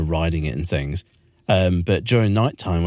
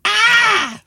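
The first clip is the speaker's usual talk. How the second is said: high trumpeting elephant shriek; elephant scream; 5 dB louder than usual. Shown as a demonstration. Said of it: "what does an elephant scream sound like".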